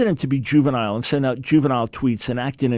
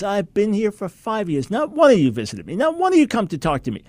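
mild retold anger; 6 semitones higher than usual. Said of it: "And he's angry".

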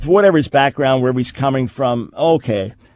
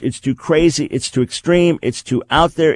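normal talking voice

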